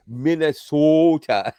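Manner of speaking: "Minnesota" stretched very slow; drawn-out Minnesota. Retold or imitated. Mocking the AI's reading, says "Minnesota" slowly. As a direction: drag out "Minnesota" syllable by syllable. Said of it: "it actually went".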